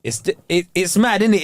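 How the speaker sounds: ordinary delivery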